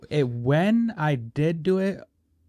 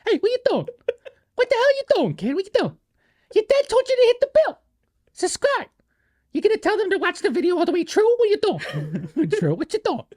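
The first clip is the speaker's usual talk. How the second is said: much higher falsetto mom, loud and scolding; feminine mobster mom; 4 dB louder than usual. Pretend. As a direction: squeal it like a nagging mobster mother. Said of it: "Can you do a little more feminine version of that?".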